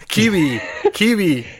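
high-pitched "Kiwi" in an unplaceable put-on accent; playfully misfired accent on "Kiwi"; botched accent attempt. Shown as a demonstration. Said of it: "I don't know what accent I, that sounded like".

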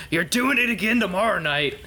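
high-pitched in-character yell. Acted. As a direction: yell it high, as if calling after someone. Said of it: "I yell".

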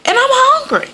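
high-pitched exasperated rant; hangry high-voiced tirade; going off on her. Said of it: "I just went the fuck off on her".

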